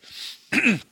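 loud rough throat clearing. Real, not acted. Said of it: "excuse my hoarse voice today".

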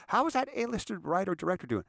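high-pitched acted question; innocent, sing-out check-in; eager inquiry. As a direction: ask brightly, pitched well up. Acts out own eager question.

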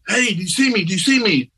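high, urgent repeated question; calling out. Retold or imitated. Friend leaning over his body, calling high and urgent. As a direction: call down to someone who may not hear.

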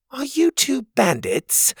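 high, slow, suspicious question. Acted. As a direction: narrow your eyes and ask slowly. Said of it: "She looked suspiciously".

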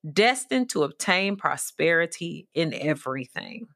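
ordinary delivery

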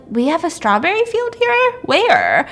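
high, puzzled rising query; baffled question. Retold or imitated. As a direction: ask as if you've never heard of it. Townspeople baffled by her question.

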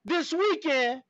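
lightly voiced Wire character; movie gangster quote. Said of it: "is that a scene from a movie?".

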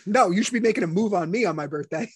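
ordinary talk, nothing marked

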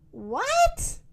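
very high, rising squeal of a question; incredulous high-pitched cow; astonished. A narrator's reading. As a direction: leap right up in disbelief. Cow astonished by turkeys' request.

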